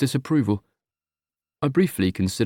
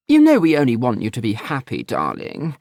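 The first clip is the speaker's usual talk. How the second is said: disapproving mother; 4 dB louder than usual. Acted.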